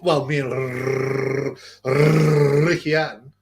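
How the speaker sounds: R in "Ricky" drawn out in a long trill; hammed-up, stretched R in "Ricky"; mock rolled R. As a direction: stall on a rolling R before "Ricky Hatton". Exaggerated imitation of someone's speech.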